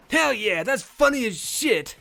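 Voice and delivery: loud, high, delighted; excited whoop. Real, not acted. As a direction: cheer it out loud. Player laughs at line.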